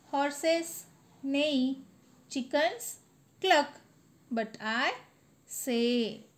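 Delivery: sing-song classroom recitation, each word held; poem recitation. Acted. Teacher reciting the animal poem to students, sing-song. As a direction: chant the rhyme for the class to repeat.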